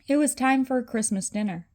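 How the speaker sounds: ordinary delivery, nothing marked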